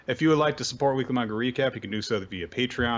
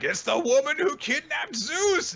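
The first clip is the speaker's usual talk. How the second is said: character voice; 4 dB louder than usual. Retold or imitated. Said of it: "And Prometheus is like".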